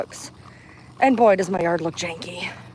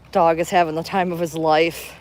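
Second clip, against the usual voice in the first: ordinary delivery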